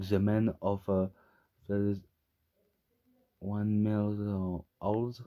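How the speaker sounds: English title in a thick French accent; bad English accent. Real, not acted. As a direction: stumble through English with a real French accent. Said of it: "j'ai un accent anglais de merde".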